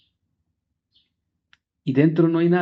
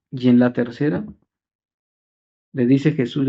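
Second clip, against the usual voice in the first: ordinary delivery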